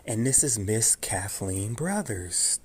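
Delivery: ordinary delivery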